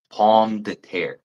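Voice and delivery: pomme de terre said slowly in French; standard French. Shown as a demonstration. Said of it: "That's potato in French".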